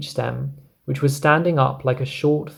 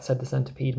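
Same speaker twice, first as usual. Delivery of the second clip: ordinary delivery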